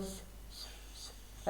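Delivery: a long unvoiced sh, sh; hissed sh sound. Shown as a demonstration. Teacher demonstrating the letter sound, a soft hissing sh. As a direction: breathe out a steady sh for the child. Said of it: "اس کی آواز ش".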